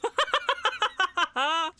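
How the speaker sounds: sudden high laugh; high burst of laughter. Real, not acted. Gamer laughing at a rival team's collapse, high laugh. Laughing at Owen's team dying.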